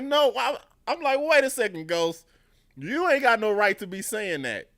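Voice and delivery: loud, very high indignant protest; hammed-up outburst at a TV character; outraged objection. Viewer yelling at Ghost from the couch, outraged.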